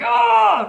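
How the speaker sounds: loud, high "aah" of rage; acted-out furious yell; sudden full-voiced roar of pain and rage. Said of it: "I just, I feel rage".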